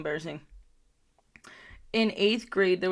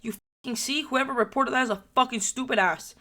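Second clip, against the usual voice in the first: higher-pitched, indignant told-you-so; acted vindication; 5 semitones higher than usual. Storyteller replays protesting after the backpack search, mock-indignant. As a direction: replay it as a righteous, high-voiced complaint.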